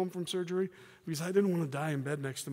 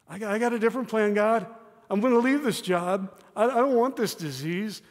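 complaining to God; 7 semitones higher, 8 dB louder than usual. Acted. Voicing a believer's complaint to God.

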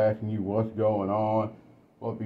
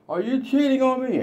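much higher accusing wife; wife's voice. Retold or imitated. Wife confronting her husband about cheating, high-pitched. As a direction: ask it up high and hurt.

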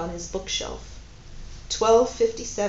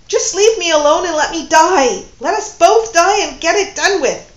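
loud, high anguished outburst; despairing yell; 9 semitones higher, 12 dB louder than usual. Acted. Woman fleeing her lover, loud despairing cry. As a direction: throw it at him as you leave.

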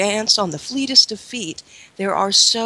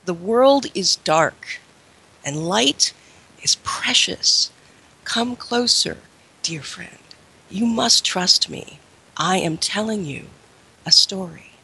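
lilting irish storyteller. Acted. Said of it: "This is the opening quote".

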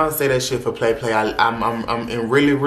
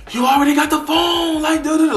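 much higher, louder mock scream; imitating his scream; 4 dB louder than usual. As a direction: ham up his panicked yelling. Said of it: "he screaming like".

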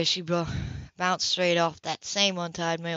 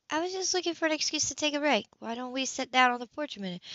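Anna's voice; 7 semitones higher than usual. Acted. Anna voiced higher.

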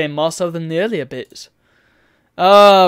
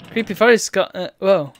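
ordinary delivery

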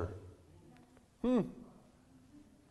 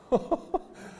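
breathy laugh. Real, not acted. Laughs after the quote.

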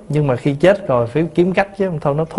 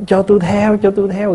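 higher, half-hearted mock wail; mock wailing mourner; 5 semitones higher than usual. Mourner at funeral begging to follow the dead, mock wail. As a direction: imitate a grieving relative's wail, lightly. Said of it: "gào, gào, gào vậy đó".

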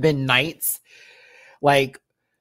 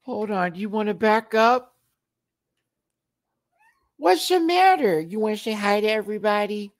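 high sing-song pet voice; cooing up high at the cat; 11 semitones higher, 3 dB louder than usual. Real, not acted. Talking to her cat in high baby talk.

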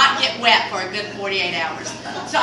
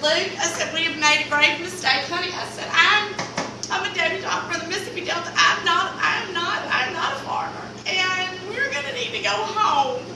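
higher, pleading to go home; high plea; 8 semitones higher than usual. Retold or imitated. To husband Luke in the mud, a high pleading protest. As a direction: beg him to take you home.

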